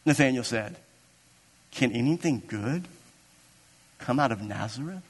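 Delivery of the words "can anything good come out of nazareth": low, quiet doubt, lifting into a question; acted disbelief, softly questioning; skeptical inflection. Nathanael answers Philip's news about Jesus, skeptical. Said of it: "That was the inflection of his voice".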